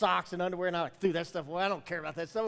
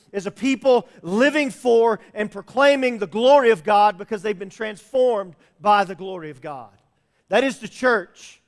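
louder, driving pulpit proclamation; preaching build; 2 semitones higher, 9 dB louder than usual. Acted. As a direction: preach it out to the congregation. Rising preaching close.

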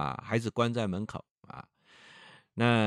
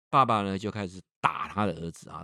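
ordinary talk

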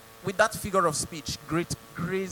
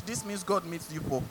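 ordinary talk, nothing marked

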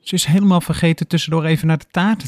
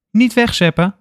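higher, louder quick protest; urgent protest; 9 semitones higher, 3 dB louder than usual. A narrator's reading. Sanna stopping Lenny zapping away, higher urgent protest. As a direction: stop him from switching channels. Sanna stops channel change.

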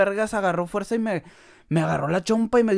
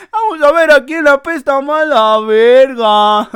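louder and slower, hammed-up challenge; loud slow repeat; 10 semitones higher, 12 dB louder, slower than usual. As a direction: belt the threat again, drawn out and loud. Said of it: "que escucho eso y que digo".

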